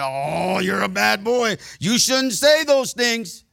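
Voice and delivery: high, rising mock reproach; exaggerated finger-wagging scold. Mock scolding he says nobody said.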